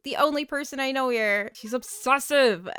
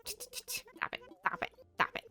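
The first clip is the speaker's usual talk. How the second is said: high, breathy whispered protest; flustered squeak; 14 semitones higher, 11 dB quieter than usual. Real, not acted. Gamer embarrassed by a two-star rating, whispered high protest.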